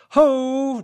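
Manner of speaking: loud high call across the field. A narrator's reading. Daniel hailing the girl in the woods, loud call. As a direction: hail her from a distance. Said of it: "Daniel called out".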